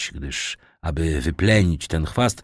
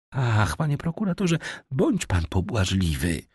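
pleading Renata; 5 semitones higher than usual. A narrator's reading. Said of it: "zawołała Renata".

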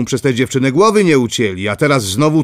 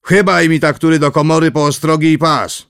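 louder, higher summons to the servants; calling to courtiers; 5 semitones higher, 3 dB louder than usual. A narrator's reading. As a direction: call the courtiers with a lord's authority. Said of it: "zwrócił się do dworzan i zawołał".

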